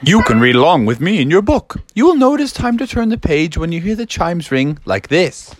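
slow, drawn-out read-along host; storybook recording intro. Acted. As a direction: announce the tale with stretched words.

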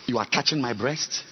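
ordinary talk

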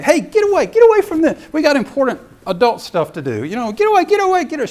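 loud, high-pitched scolding disciples; shooing away. Acted. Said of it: "shooing the kids away".

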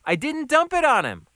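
loud, high-pitched protest; wrongly accused waiter protesting; indignant denial. Acted. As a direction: deny it hotly, voice jumping up.

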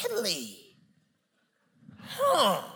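high, drawn-out mock surprise; Holy Spirit voice. Holy Spirit doubting daddy knows best, mock surprised. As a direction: stretch it out, feigning wonder. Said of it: "The Holy Spirit gonna start talking".

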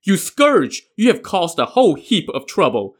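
furious outburst, mostly in the opening cry; angry shout. Acted. Father scolding his son for killing a dragon, shouting. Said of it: "he shouted".

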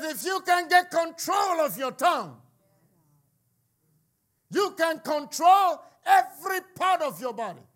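high, shouted preacher proclamation; full-force preaching. Acted. Preacher repeating the point for emphasis, full-force shout. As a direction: hammer the point home at a shout.

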